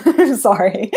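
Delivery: amused sorry, laughter breaking in; laughing apology. Real, not acted. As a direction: apologise through a real laugh. Laughs apologising after denying sounding English.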